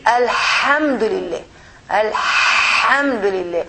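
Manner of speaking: Alhamdulillah, the ha pushed hard from the throat; slow, stretched Alhamdulillah with a heavy ħ. Shown as a demonstration. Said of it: "You feel the letter ha".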